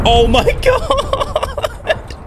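drawn-out high squeal broken by laughter; real laughing scream at the screen; laughing shriek. Real, not acted. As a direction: shriek it slowly through laughing.

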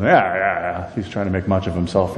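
mild mock scoff; mock dismissive. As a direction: brush him off with a sneer. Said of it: "we can be very critical of him".

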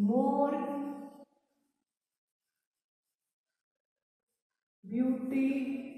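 drawn-out, spaced-out dictation pace; slow dictation. Shown as a demonstration. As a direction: stretch each syllable, leaving long gaps. Dictating the answer word by word.

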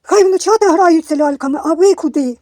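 high, loud imitation of a scold; exaggerated scandalised scolding; mocking a hater's comment. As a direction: mimic an indignant online commenter. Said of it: "Тому що одна написала".

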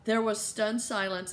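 ordinary delivery, nothing marked

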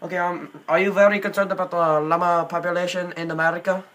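put-on earnest question in an Indian accent. Prank caller asking staff about llamas, in Indian accent. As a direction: ask it politely, Indian-accented.

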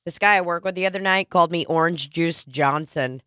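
ordinary talk

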